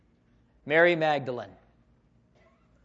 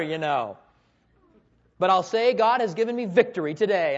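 ordinary talk, nothing marked

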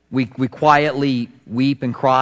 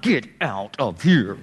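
slow, lower, softer stern command; firm authority; 5 semitones lower, 5 dB quieter, slower than usual. Retold or imitated. Jesus ordering the mourners out, low and stern. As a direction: send them out with quiet authority. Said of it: "authority of Jesus".

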